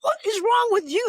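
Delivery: exasperated retort. Retold or imitated. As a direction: fire it back at him in disbelief. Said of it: "I was like".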